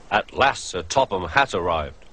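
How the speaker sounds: ordinary delivery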